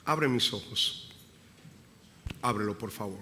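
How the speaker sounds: soft, fading prayer to God; an intimate prayer that sinks to a murmur; quiet prayer. Real, not acted. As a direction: pray it low and pleading. Pastor prays aloud, fading.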